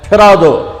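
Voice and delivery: ordinary talk, nothing marked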